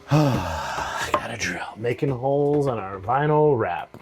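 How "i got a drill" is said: slow sigh, lower, softer delivery; sighing, deadpan. Shown as a demonstration. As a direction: sigh it out, mock resigned.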